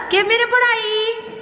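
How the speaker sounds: high, slow cooing voice. Real, not acted. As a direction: coo at the puppy. Cooing at the dog.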